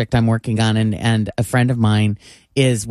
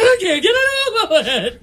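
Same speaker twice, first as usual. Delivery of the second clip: very high, squealing gush with a laugh; over-the-top excited. Retold or imitated. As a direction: gush like a thrilled fan. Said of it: "No, he was like".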